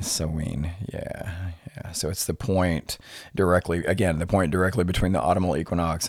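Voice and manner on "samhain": Samhain repeated as suh-WEEN; more accurate pronunciation. Shown as a demonstration. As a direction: say Samhain with a long "ee" in the second syllable. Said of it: "it's actually more accurately pronounced".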